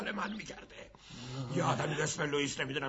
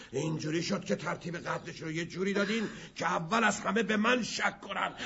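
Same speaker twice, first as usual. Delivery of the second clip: usual speaking voice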